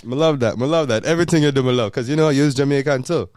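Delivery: loud, delighted Jamaican patois. Acted. Host praising fiancee as Jamaican too, loud patois. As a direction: cheer her on in patois. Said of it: "the whole episode in patois".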